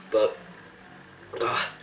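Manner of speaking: ordinary talk, nothing marked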